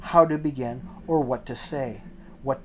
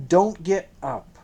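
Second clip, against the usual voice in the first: commanding; 4 semitones higher, 5 dB louder, slower than usual. A narrator's reading. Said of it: "he commanded".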